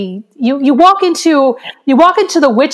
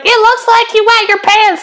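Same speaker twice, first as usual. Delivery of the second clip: high, mocking jeer; retold teasing; 9 semitones higher than usual. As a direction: imitate a friend's gleeful taunt.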